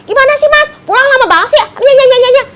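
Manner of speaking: high, whiny nagging, hammed up; mock angry wife. Wife angry at her husband by day, high hammed-up nagging. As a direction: nag in a shrill comic rush, ending in mimicked yapping. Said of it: "siang-siang marah nih sama suami".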